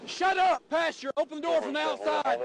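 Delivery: loud high commanding yell; shouting. Real, not acted. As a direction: bark orders at the passenger. Trooper ordering passenger at stop.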